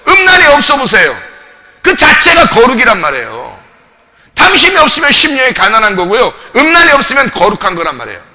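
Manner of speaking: loud, high-pitched preaching, pressing each point home; forceful preaching. Real, not acted. Preacher urging the congregation to empty themselves, forceful preaching. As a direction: preach it hard at full voice.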